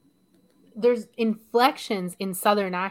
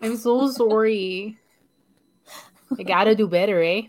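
put-on Canadian accent; 3 semitones higher, 5 dB louder than usual. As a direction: lean playfully into a stock Canadian accent. Mock Canadian apology after accent talk.

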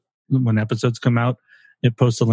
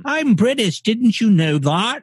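much higher, hammed-up British accent; cartoon British accent; 10 semitones higher than usual. Showing friend his shitty cartoon British accent. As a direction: squeak it out like a cartoon Brit. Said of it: "It's my cartoon accent".

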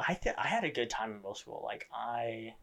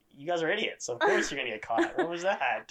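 mock exasperation; 7 semitones higher, 4 dB louder than usual. Retold scolding of classmates.

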